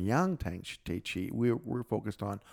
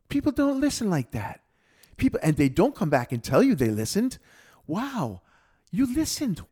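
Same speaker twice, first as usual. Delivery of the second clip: high, louder retold amazement; surprised; 10 semitones higher, 6 dB louder than usual. Critic amazed that the student listened, surprised. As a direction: imitate astonishment, pitched well up. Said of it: "she was so surprised".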